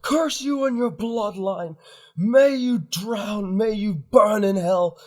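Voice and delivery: high, full-voiced furious shouts; loud, drawn-out cries of condemnation; crowd hurling curses. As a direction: hurl each curse like an enraged onlooker.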